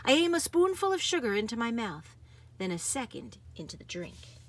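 plain reading voice

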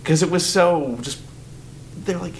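normal talking voice

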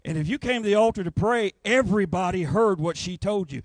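ordinary talk, nothing marked

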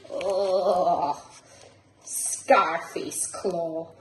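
usual speaking voice

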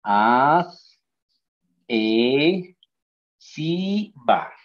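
syllables drawn apart one by one; wrong syllable split. Shown as a demonstration. Teacher shows class a wrong split, chopped syllable by syllable. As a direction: split it as AD, E, SI, VO, each held with a gap. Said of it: "se sí la ve así".

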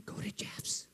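hushed near-whisper; soft, secretive imitated voice; still small voice. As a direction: whisper it as a quiet inner prompting. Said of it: "I'm hearing a still small voice".

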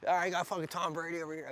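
mock Monday Night Football commentator; football announcer. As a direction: call it like a play-by-play man. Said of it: "Fox Monday Night Football game".

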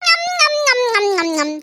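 smacking lips while eating over the phone; munch noisily, mouth full; loud chewing noises. Acted. Wife chewing loudly into the phone to tease husband, munching. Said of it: "ஞங்க, ஞங்கன்னு தின்னுகிட்டே இருக்க".

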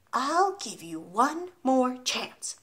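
slow, softened, drawn-out threat; menacing headmistress holding back; ominous warning. A narrator's reading. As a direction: warn quietly, spacing out every word. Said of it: "the Trunchbull said, not moving".

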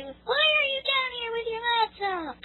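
very high, slow falsetto family voice; mock family member. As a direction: whine it like a nagging relative up in falsetto. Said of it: "come down to my room and say".

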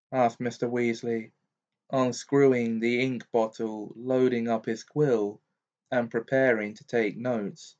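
ordinary delivery

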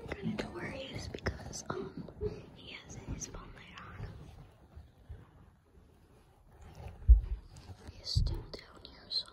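hushed unvoiced whisper. Real, not acted. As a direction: whisper so the seeker can't hear. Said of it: "because I have to whisper".

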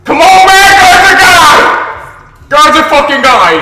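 loud exasperated shouts; frustrated yelling. Real, not acted. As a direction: yell at the game. Frustrated at game.

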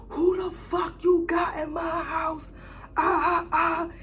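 high, slow, crying yell of an outraged mother; mom wailing. Retold or imitated. Mother yelling at her daughter, crying high wail.

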